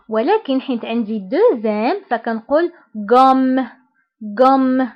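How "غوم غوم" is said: غوم with a held, pressed double m; teacher stressing the double m in غوم; pressing the m. Shown as a demonstration. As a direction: lean on the m in غوم. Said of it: "كنضغط على هاد الام".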